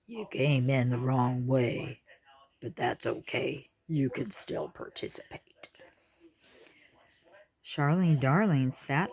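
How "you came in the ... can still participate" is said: matter-of-fact. A narrator's reading. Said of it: "saying matter-of-factly".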